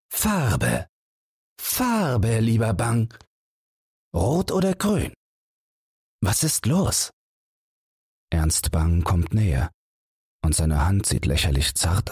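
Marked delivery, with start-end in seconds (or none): 0.1-7.1 higher, cutting through the smoke; piercing voice. Acted. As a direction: pierce the room with a higher, urgent voice. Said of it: "seine Stimme bohrt sich durch den Qualm". (Holzer dringt mit hoher, bohrender Stimme durch den Qualm)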